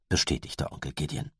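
ordinary delivery

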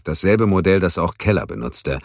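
usual speaking voice

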